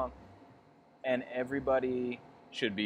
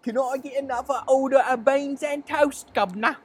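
high, louder hammed-up cockney; wife ordering breakfast in cockney; cockney accent; 13 semitones higher, 6 dB louder than usual. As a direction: order beans like a London cabbie. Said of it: "it's getting a little cockney".

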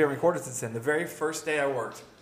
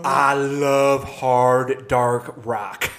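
mock metalhead; 3 semitones lower, 5 dB louder, much slower than usual. Jokey mock rocker declaration.

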